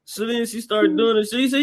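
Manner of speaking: ordinary delivery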